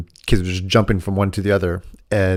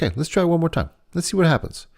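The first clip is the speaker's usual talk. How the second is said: gentle encouragement; 9 semitones higher than usual. Retold or imitated. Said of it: "I'm like".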